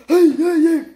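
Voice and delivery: high, loud piercing hey; manic yell. Retold or imitated. Employee charging up, high loud manic yell. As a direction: shriek it like the bagger. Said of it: "run up and go".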